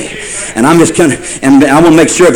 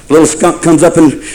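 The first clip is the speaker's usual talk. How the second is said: ordinary talk, nothing marked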